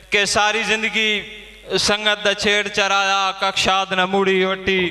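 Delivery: high, sustained verse declamation; poem recitation. Acted. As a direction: recite it to the whole gathering. Poet recites verse at gathering.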